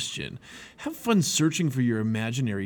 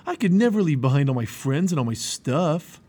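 put-on loud, higher stubborn boy voice; stubborn protest; 4 semitones higher, 6 dB louder than usual. A narrator's reading. Obstinate refusing to leave his friends, stubborn.

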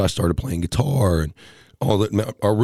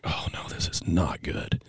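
whispered dread; 4 semitones lower, 10 dB quieter than usual. Retold or imitated. Inner panic at being pulled over.

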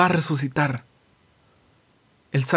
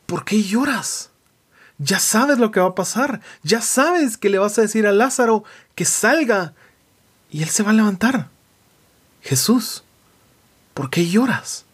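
higher-pitched, imploring imagined questioner; questioning Jesus; 5 semitones higher than usual. Retold or imitated. A believer asks Jesus why He weeps, baffled. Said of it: "Y uno diría".

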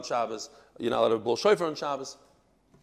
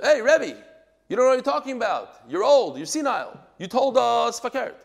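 loud, high, brash mock insult; mock rude outburst; 8 semitones higher, 7 dB louder than usual. Student shouting down his old rebbe, mock rude and loud. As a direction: ham up the disrespect as a bad example. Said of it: "No, that's not how you talk".

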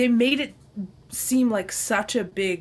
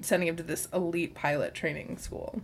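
normal talking voice

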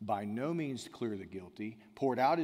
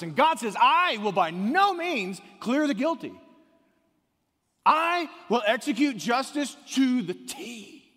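much higher and louder, shouting preacher; preaching at full force; 9 semitones higher, 10 dB louder than usual. Acted. Pastor thundering God's words to the congregation, full-force preaching. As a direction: thunder God's justice and mercy at the room.

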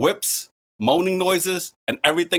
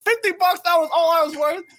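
laughing disbelief. Retold or imitated.

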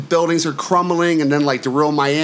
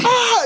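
high mock scream. He reenacts screaming on the interstate, high mock scream. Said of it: "started screaming".

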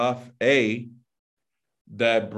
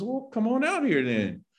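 much higher, sing-out invitation; acted casual beckoning; 11 semitones higher than usual. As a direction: call him out in a high, easy voice. Voicing the command to Peter on water.

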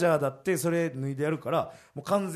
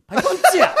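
high, loud mock Tosa dialect; 12 semitones higher, 10 dB louder, much faster than usual. Shown as a demonstration. Comedian tries the phrase in Tosa dialect, high and loud. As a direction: belt it out in Tosa dialect, laughing. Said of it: "土佐弁の、".

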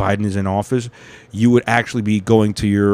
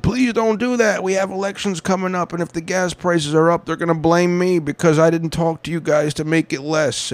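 pleading politician; 6 semitones higher than usual. Retold or imitated. President begging oil producers before the elections, high mock plea. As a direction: plead in a put-on higher voice.

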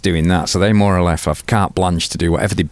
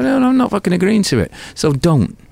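defiant refusal; 7 semitones higher, faster than usual. Retold or imitated. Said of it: "And I go".

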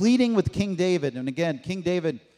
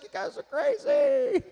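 very high mock jeering voice; high-pitched hammed-up mockery; mocking onlookers. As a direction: squeal it as a scoffing crowd. Mocking onlookers at the ark builders.

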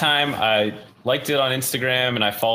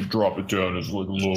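deep-voice contest; 5 semitones lower than usual. Shown as a demonstration. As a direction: drop your voice down low. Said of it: "see whose voice is deeper".

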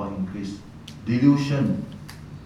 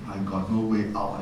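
ordinary talk, nothing marked

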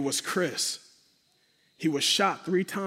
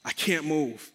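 usual speaking voice

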